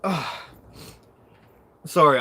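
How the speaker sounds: normal talking voice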